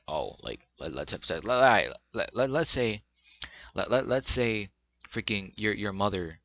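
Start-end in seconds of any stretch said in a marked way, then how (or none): 0.1-1.4 low, soft mock-horny dude voice; mock boyfriend. Mocking her pushy boyfriend, low and soft.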